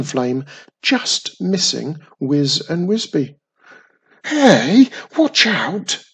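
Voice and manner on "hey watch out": high, loud alarmed scream. A narrator's reading. Wisby screams as the dragon's flame just misses them. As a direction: yell a warning at Wiz. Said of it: "screamed Wisby".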